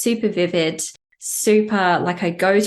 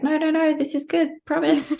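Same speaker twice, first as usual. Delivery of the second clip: much higher, coaxing reassurance; playful high voice of the higher self soothing the human; 9 semitones higher than usual. Acted. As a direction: coax the human along, pitched high. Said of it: "wrestling ... between the human and the higher self that was like".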